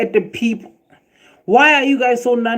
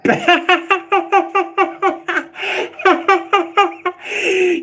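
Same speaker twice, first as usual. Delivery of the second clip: long high burst of laughter; real laughing fit; 13 semitones higher than usual. Real, not acted. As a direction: crack up at the comment. Laughs at comment.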